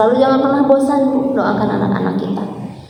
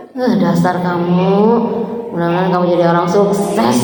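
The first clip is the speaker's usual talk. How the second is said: mock tender curse; 5 semitones lower than usual. Shown as a demonstration. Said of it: "kutuk anaknya jadi orang sukses".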